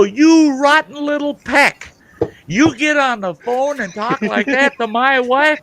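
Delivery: high, loud mock outrage at the little man; hammed-up furious yelling; retold angry tirade. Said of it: "I'm bent out of shape".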